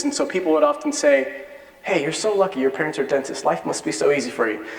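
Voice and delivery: ordinary talk, nothing marked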